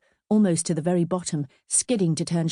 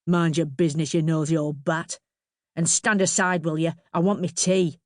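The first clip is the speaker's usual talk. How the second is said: gruff father. A narrator's reading. Father snaps back at the neighbour.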